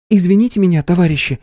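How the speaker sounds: high, polite plea to stop them; polite young woman. A narrator's reading. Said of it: "попыталась она остановить мужчин".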